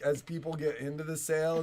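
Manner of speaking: ordinary delivery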